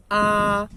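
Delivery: "a" loud, long and level; sustained Mandarin first tone on "a"; high flat tone. Shown as a demonstration. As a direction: hold "a" on one unwavering high pitch. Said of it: "que é alta e plana".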